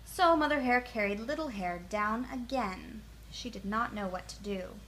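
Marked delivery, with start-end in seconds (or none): none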